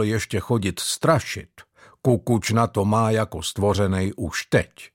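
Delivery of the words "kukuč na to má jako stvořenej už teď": plain reading voice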